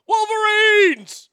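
high, drawn-out full shout; movie battle cry. Acted. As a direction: yell it long like a rallying war cry. Yells Red Dawn battle cry.

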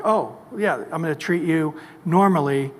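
ordinary talk

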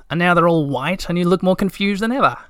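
high, rising curiosity; interested. A narrator's reading. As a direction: pipe up with lively interest. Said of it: "said Little My interestedly".

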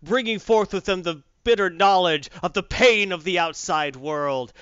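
grand, booming mock-epic storyteller; epic narration. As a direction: orate like an epic saga narrator.

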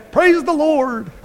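a hammed-up joyful shout to heaven; high slow praise. Preacher cheering the saved dog, high and slow. Mock celebration of dog revival.

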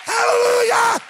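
high, loud praise cry; worship shout. Real, not acted. Preacher calling out praise to the congregation, high full shout. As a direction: shout it out to the whole church.

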